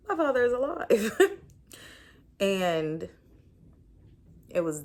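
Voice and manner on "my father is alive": laughing through the words; laughing disbelief. Real, not acted. Laughs at the inner voice.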